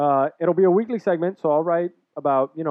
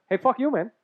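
impression of a friend; 9 semitones higher than usual. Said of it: "what was your impression?".

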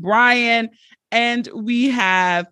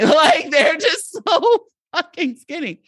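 laughing in disbelief; 8 semitones higher, slower than usual. Real, not acted. Laughing in disbelief at tiny cast.

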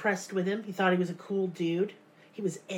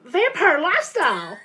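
"Vampire lifestyle" punched high and loud in mock disdain; mocking emphasis; 8 semitones higher, 8 dB louder than usual. Shown as a demonstration. Host asked for one more time, loud mocking sneer. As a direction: repeat it on request, sneering loudly. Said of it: "One more time".

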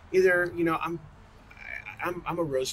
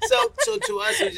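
high laughing burst into words; 8 dB louder than usual. Real, not acted. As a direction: laugh it out, then talk.

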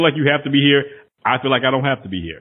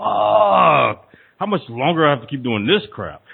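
frustrated groan; slower than usual. Retold or imitated. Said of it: "I'm like".

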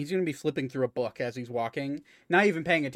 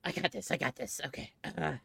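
soft confident nerd; brushing off help, nerdy; 2 semitones higher, 5 dB quieter than usual. Acted. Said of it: "get my nerd voice on".

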